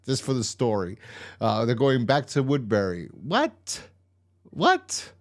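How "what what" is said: squeaky-high rising disbelief; incredulous. Acted. Viewer balking at a nonsensical plot turn, incredulous. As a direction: jump way up in pitch, more so on the repeat. Disbelief at where the story goes.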